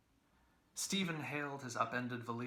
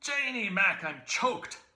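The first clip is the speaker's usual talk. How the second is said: high, loud howl; 10 semitones higher, 11 dB louder, slower than usual. A narrator's reading. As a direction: howl it out over the fire. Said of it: "he howled".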